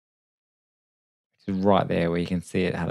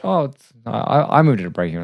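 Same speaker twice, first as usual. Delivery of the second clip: ordinary talk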